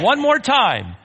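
very high, loud call; ringing call to the congregation; rallying shout. Real, not acted. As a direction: whip the crowd up for a last go. Urging congregation to repeat louder.

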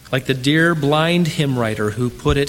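usual speaking voice

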